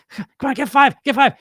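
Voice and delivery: loud, high pestering inner voice; insistent urging. Acted. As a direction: egg yourself on, hammed-up. Acted inner voice urging for more.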